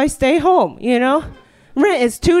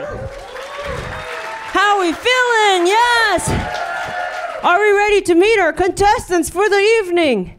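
high, drawn-out shout to the crowd; hype host shout; 7 semitones higher, slower than usual. Real, not acted. Host rallying the audience before contestants, high shout. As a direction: work up the crowd like a game show host.

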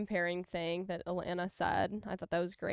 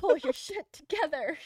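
high, louder bossy designer voice; Edna Mode; 7 semitones higher, 4 dB louder than usual. Retold or imitated. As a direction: snap it out like a fierce tiny designer. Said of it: "Like Edna Mode with her".

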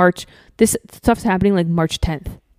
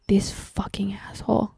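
whispered curse; 10 dB quieter than usual. Retold or imitated. Whispered angry thought about ex.

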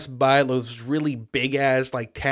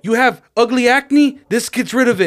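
pushy sales pitch; 9 semitones higher, 8 dB louder than usual. Retold or imitated. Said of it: "He's like".

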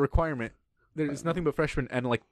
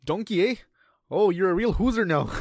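loud, higher mock-Canadian ogre; Canadian accent; 5 semitones higher, 4 dB louder than usual. Imagining the movie ogre as Canadian, hammed-up Canadian accent. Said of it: "in a Canadian accent".

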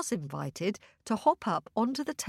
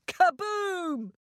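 high, big storybook boom; dramatic kaboom; 10 semitones higher than usual. A narrator's reading. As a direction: burst out the magic bang. Spell breaks.

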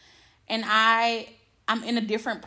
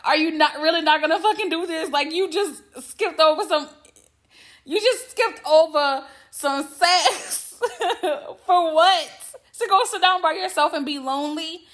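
high, loud, laughing rant at herself; self-scolding rant; 5 semitones higher, 4 dB louder than usual. Retold or imitated. Berating herself for skipping sex, laughing rant. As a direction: scold yourself through laughter. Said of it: "normally in a situation like that, I'm like".

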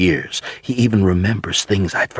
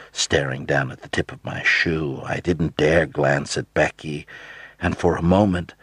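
plain reading voice

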